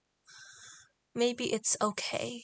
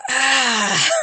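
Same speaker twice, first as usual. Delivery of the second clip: sudden loud cry; loud startled ah; 3 semitones higher, 14 dB louder than usual. Real, not acted. Streamer startled while drawing, loud cry. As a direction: blurt it out.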